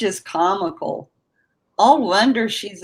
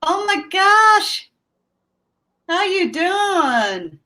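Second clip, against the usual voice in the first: excited greeting; 10 semitones higher, slower than usual. Real, not acted. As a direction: stretch it out high, delighted to see him. Greeting returning friend David live.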